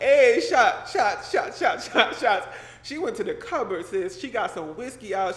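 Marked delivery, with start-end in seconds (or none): 0.0-2.5 much louder and higher, chanting shots; a hostess hyping up a drink; hyped-up wife. Retold or imitated.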